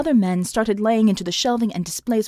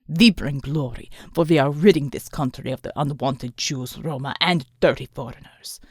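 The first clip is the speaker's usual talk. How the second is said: low cold villain; 8 semitones lower than usual. A narrator's reading. The thug tells the customers, low and cold.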